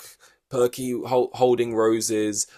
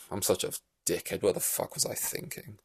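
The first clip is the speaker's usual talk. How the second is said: much softer, whispered self-pitying mutter; mock self-pity; 10 dB quieter than usual. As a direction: mope alone over the champagne. Boss alone with melted champagne.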